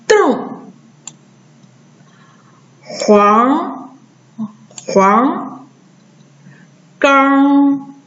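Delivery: 瞪, 黄, 刚, 瓮 ending in nasal curled r; nasalised erhua. Shown as a demonstration. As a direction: model 瞪, 黄, 刚, 瓮 singly, each keeping its tone. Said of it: "鼻化变成后鼻音读起来".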